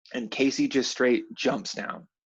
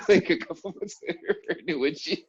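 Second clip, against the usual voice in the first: laughing; 7 semitones higher, faster than usual. Real, not acted. Laughing about paying.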